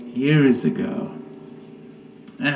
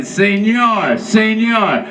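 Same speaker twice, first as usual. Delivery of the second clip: put-on higher, calling Senor with a Spanish accent; mock Mexican cop; 8 semitones higher, 4 dB louder, slower than usual. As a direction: hail him like a stern cop. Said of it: "a big badge on 'em saying".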